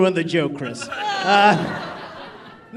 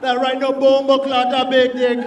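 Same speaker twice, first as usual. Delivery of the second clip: a hint of a Jamaican accent, a bit louder; mock Jamaican accent; 4 semitones higher, 4 dB louder than usual. Comic bragging in bed in a light mock Jamaican accent. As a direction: boast in a playful put-on Jamaican accent. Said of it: "sometimes I just turn Jamaican".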